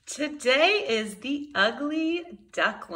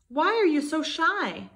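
kind swan; 6 semitones higher, 3 dB louder than usual. Acted. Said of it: "he asked".

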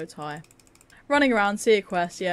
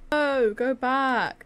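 drawn-out groan; 6 semitones higher, 4 dB louder, much slower than usual. Real, not acted. Frustrated at game.

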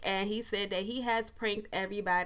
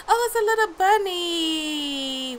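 delighted; 8 semitones higher, 6 dB louder than usual. Real, not acted. Delighted at bunny.

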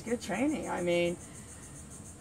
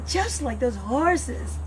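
very slow, louder, savoring each word; slow dramatic repeat; 3 semitones higher, 7 dB louder, much slower than usual. Acted. Trainer savoring the horse comparison, slow and dramatic. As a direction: stretch it out like an epic line.

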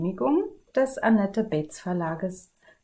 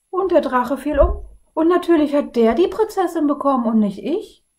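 higher and louder, building to complaint; indignant prince; 4 semitones higher, 6 dB louder than usual. Acted. Tired prince complaining to the others, rising indignation. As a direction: rant about the unfair dragon fights. Weary prince tells grievance.

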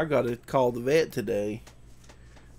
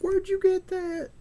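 much higher, slow mock scold; scolding the dog; 4 dB louder than usual. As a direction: squeak it out like a surprised owner. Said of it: "And I'd be like".

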